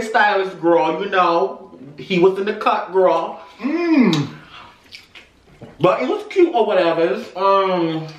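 talking while chewing; biting into chicken wing; mouth full of food. Real, not acted. Seen 2: Left man lifts wing, then bites it; unclear which man speaks.

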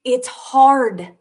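anguished outburst. Real, not acted. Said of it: "I'm frustrated right now".

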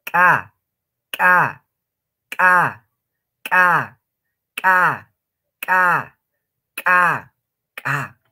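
loud KA with a popped K, then a short gap; ejective K. Shown as a demonstration. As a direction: click the K sharply before each A. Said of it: "K con su apóstrofo".

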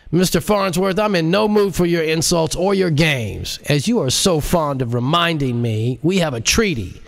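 a stiff, fed-up bureaucrat; irritated director. Acted. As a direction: warn him sharply before being cut off. Director threatening, cut off.

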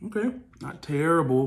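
food in mouth; tasting and reacting mid-chew; talking while chewing. Real, not acted. Seen 0: took a shrimp bite earlier; lips pressed, jaw working while reacting.

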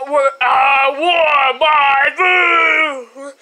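slow, loud complaint; angry shout. Acted. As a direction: stretch it out like a tantrum. Demanding food loudly.

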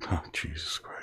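very soft, low whispered oath; dismayed curse. Real, not acted. Reader dismayed by a strange word, whispered curse. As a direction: swear under your breath.

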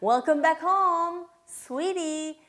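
high, slow, fond greeting; loving welcome. Pretend. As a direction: welcome the child home like a cooing mom. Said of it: "하게 되면".

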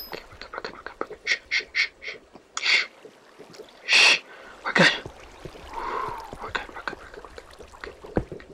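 tiny voiceless whisper with shushing; whispered reassurance. Real, not acted. Gamer calming down after fleeing the splashy thing, whispering. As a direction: hush yourself down, barely audible.

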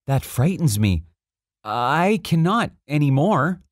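abashed little prince, voice rising and slowing; abashed murmur. A narrator's reading. Little prince answering the king's order, abashed. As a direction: shrink from the order, mildly abashed. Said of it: "murmured the little prince".

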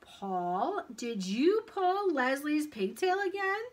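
slow, rising, loud interrogation; drawn-out accusing question; stern teacher. A narrator's reading.

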